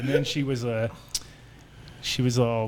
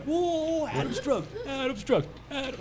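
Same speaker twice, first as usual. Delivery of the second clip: mock classmates cheering; 13 semitones higher than usual. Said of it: "everybody was like".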